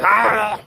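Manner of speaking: put-on high, sick drunken cat squeak; half-dead gargled meow. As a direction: imitate a cat's garbled meow. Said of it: "half dead kind of gargle".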